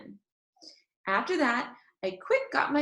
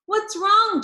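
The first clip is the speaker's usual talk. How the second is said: put-on high, loud, concerned boy; boy asking his friend what's wrong; Herb worried; 13 semitones higher, 8 dB louder than usual. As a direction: call out in sudden worry. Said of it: "he said".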